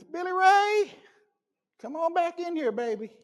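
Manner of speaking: high calling mother's voice; mom calling. Retold or imitated. Mother calling her son back into the room. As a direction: call him in like his mom.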